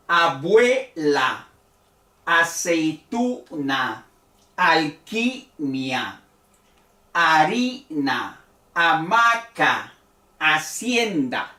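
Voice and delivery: slow and deliberate, opening A left unstressed; slow unstressed A demo. Shown as a demonstration. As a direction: spell out each word slowly so the first A stays weak. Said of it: "aquí la A es atona".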